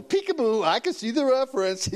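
high, gleeful mock sing-song with a giggle; teasing little-kid cheat; playful peekaboo. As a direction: pretend to sneak a peek like a child. Acting out peeking at the answer.

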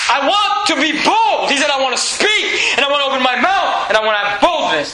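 ringing pulpit cry of boldness; bold proclamation. Acted. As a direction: proclaim it boldly, stretching each key word. Preacher acts out Paul's boldness.

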